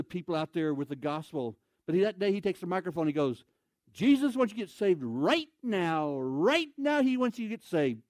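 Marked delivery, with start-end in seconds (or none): 3.9-8.0 high, drawn-out "right now"; imitated young preacher's emphatic call; urgent preaching. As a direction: proclaim it over a microphone, stretching the words. Teen preaching over the microphone.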